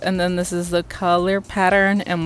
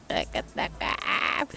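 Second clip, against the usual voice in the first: breathy, voiceless gush, drawn out; stretched, airy squeal of delight; overcome with awe; 8 semitones higher, 9 dB quieter, much slower than usual. Real, not acted. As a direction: let the voice vanish in amazement. Overwhelmed by the pigment.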